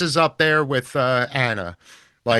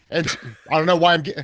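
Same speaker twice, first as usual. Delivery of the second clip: usual speaking voice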